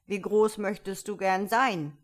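lightly voiced commanding caterpillar; caterpillar character voice. A narrator's reading. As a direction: question Alice as a stern caterpillar. Caterpillar questions Alice.

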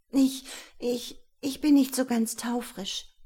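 high, halting, ashamed admission; embarrassed stammer. A narrator's reading. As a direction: stumble over the words, blushing. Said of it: "errötete Melanie bis an den Haaransatz". (verlegenes Stammeln)

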